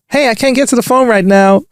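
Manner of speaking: high, bright voicemail voice; voicemail greeting. Retold or imitated. Imitating the hiker's voicemail greeting, pitched high. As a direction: play the cheery recorded greeting.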